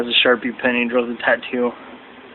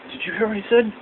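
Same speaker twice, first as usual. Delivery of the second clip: higher and softer, secretive aside; imitating R-Truth; 10 semitones higher, 6 dB quieter, faster than usual. R-Truth asks his imaginary friend, high soft aside. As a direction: lean in and ask the invisible friend. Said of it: "pretending like he has imaginary friends".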